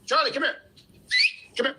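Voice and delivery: quick summons to a pet; calling a dog. Real, not acted. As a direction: call the dog over by name.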